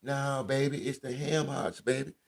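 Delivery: high, loud mock grandma insistence; grandma correcting. Grandma corrects grandchild about seasoning, high insistent imitated voice. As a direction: imitate grandma insisting, stretching the last repeat.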